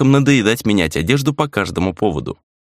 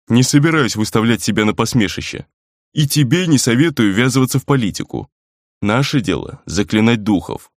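firm, stern admonishment. A narrator's reading. Friend warning Rei off politics, stern. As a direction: lay it down sternly. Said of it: "сурово ответил тот".

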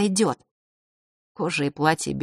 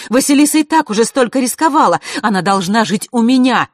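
heated outburst; 7 semitones higher, 7 dB louder than usual. Acted. Queen keeps arguing heatedly.

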